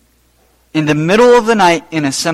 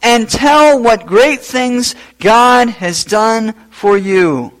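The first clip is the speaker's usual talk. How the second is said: slow commanding words; 6 semitones higher, 4 dB louder, slower than usual. Retold or imitated. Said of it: "And Jesus tells him this".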